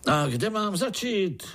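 high, pleased storyteller's question; contented calling out. A narrator's reading. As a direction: call out contentedly, pitched high. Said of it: "křičel strýček spokojeně". (spokojené zvolání vysokým hlasem)

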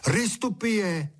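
high, drawn-out call. A narrator's reading. Boy under the window calls out at a bird, calling. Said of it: "koukal na ptáka u louže a volal".

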